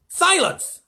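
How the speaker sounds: high, loud snap; snapped order. A narrator's reading. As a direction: cut them off with a shout. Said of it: "snapped the president".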